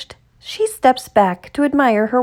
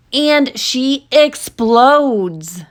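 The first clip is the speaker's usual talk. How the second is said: dramatic build; 3 semitones higher, 3 dB louder, much slower than usual. A narrator's reading. Girl's outburst in the story.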